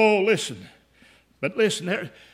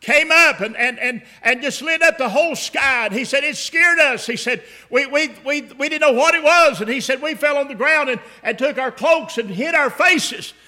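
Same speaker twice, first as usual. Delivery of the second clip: excited retelling; 5 semitones higher, 6 dB louder than usual. Retold or imitated. Shepherd recalls terrifying light.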